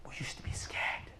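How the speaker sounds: low whispered admission; hushed confession. Acted. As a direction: let it sink almost to a whisper. Paradise people confess their fear.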